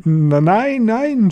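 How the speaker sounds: high, panicked stammer climbing upward; pleading. A narrator's reading. Front-row witch begging for mercy, high frightened plea. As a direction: beg with rising high panic. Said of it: "flehte die Hexe".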